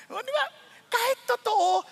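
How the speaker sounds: normal talking voice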